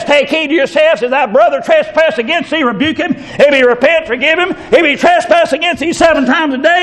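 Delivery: high-pitched preacher reading scripture; fiery preaching. Acted. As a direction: preach the verses out with fire. Preaching scripture at full force.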